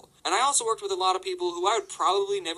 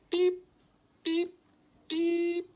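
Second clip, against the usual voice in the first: high, monotone button beeps; short high beeps, spaced apart; imitated keypad beeps; 14 semitones higher, much slower than usual. Imitating each button pressed on the alarm panel, high beeps. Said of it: "each button makes a beep".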